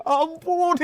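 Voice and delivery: high, loud mock wail for mercy; hammed-up sobbing plea; imitated wailing. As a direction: bawl it like a crying child. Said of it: "menangis meraung-raung".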